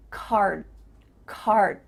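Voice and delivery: low "card", tongue stops on d; unreleased D. Shown as a demonstration. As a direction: shape the d in "card" without releasing it. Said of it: "your mouth is making that shape".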